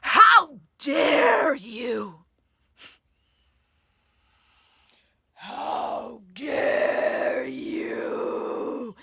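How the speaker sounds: drawn-out roaring outrage, very slow; furious shout. Acted. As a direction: scream it like a fight reaction. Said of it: "there's the fight stress response. It's like".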